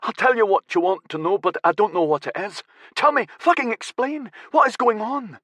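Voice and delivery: high, urgent pleading; panicked captive. Acted. Captive begging his captor to explain, high and panicked.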